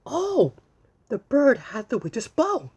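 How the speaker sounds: high, loud delighted oh; storyteller amazed for the kids; surprised discovery. Acted. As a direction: gasp at the found bow. Gasp at bird with bow.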